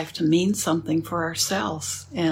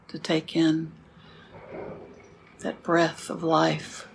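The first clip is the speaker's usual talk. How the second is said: guided meditation; 4 dB quieter than usual. Acted. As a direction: guide the sitting gently, with long pauses. Leads a sitting meditation.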